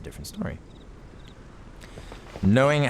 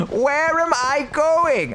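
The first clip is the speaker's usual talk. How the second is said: high, slow, drawn-out whine; hammed-up high lament; mock wail; 4 dB louder, much slower than usual. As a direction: wail it like a lost hero. Silly high wail in game.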